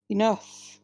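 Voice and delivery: ordinary delivery, nothing marked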